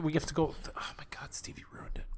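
hushed unvoiced aside; whispering. Real, not acted. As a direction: whisper it so the bit goes on.

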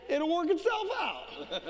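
chuckling it'll-be-fine; laughing punchline. Retold or imitated. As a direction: let the laugh break in. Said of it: "I said".

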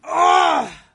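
loud, high cry of pain; real agonized yell; pained scream. Real, not acted. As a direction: scream as the burn hits. Spicy burn hits the nose.

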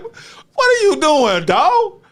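high, drawn-out incredulous question; stretched-out, high-pitched disbelief; retold astonishment. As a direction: mimic someone aghast at what you're doing. Retold astonished reaction from others.

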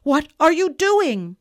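loud, pleading outcry; alarmed cry. A narrator's reading. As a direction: call out in horror at her. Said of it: "he cried".

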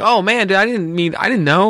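high-pitched, defensive backpedalling; retold flustered apology. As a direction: act out apologizing after a blunder. Retold apology to offended man.

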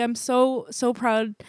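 ordinary delivery

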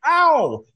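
high loud "Ow!"; dad yelping as a fly bites him; mock yelp of pain.

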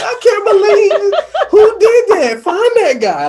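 high laughing shout, slow; almost crying with joy, shout it out; overjoyed disbelief. Retold or imitated. Host retelling his joy at a fan's call, laughing high shout.

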